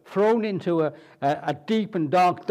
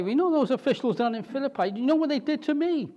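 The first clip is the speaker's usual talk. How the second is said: much higher, fast mock outrage; outraged complaint; 7 semitones higher, faster than usual. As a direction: tell the proconsul, aghast. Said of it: "And say".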